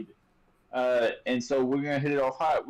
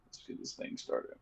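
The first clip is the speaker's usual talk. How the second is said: whispering; 14 dB quieter than usual. Real, not acted. Quiet aside before the show starts.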